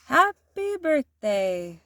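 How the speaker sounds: slow, drawn-out snowman voice; newly alive snowman greeting the children slowly; snowman's first words. A narrator's reading. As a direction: stretch it out like a snowman waking up. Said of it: "said the snowman".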